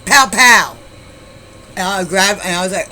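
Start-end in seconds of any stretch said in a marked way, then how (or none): 0.1-0.8 loud, punched gunshot noises; imitated gunshots. Storyteller recreating shots heard while running, loud imitation. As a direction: fire off two shots with your voice.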